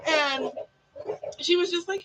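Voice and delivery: ordinary delivery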